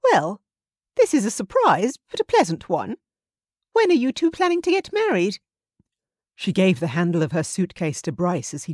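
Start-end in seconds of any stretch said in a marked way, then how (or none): 0.0-5.4 higher, gracious hostess tone; a mother recovering her poise; Justine's high voice. A narrator's reading.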